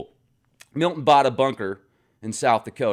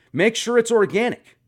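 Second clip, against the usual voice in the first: "Make sure it's organic" said high, louder, rising on "it's"; high emphatic; 9 semitones higher, 4 dB louder than usual. Shown as a demonstration. Advising a viewer to relax, the high emphatic take.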